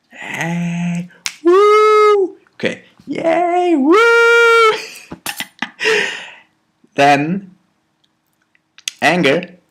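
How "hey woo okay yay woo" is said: high, loud whoops of joy; happy cheering. Shown as a demonstration. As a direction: cheer like pure happiness. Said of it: "Happiness, full expansion".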